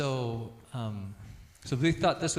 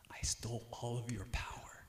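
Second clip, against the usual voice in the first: whispered threat; 5 dB quieter than usual. Retold or imitated. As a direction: whisper it into a little brother's ear like a spooky secret. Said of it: "whispered in his ear".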